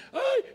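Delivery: one very high "ay" yelp; imitated cry. Student clutching her middle starts to scream, imitated high cry. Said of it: "y empieza a gritar".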